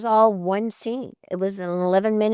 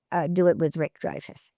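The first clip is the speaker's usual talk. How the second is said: usual speaking voice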